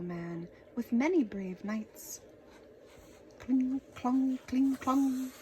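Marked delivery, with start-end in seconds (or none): 3.4-5.3 chanted metallic cling clong; clanging sword sounds. Acted. Narrator mimics swords clashing in battle.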